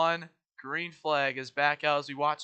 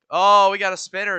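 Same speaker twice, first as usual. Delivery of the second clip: excited; 4 semitones higher, 13 dB louder than usual. Real, not acted. Spin during race.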